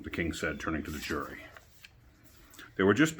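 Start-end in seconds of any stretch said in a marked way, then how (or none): none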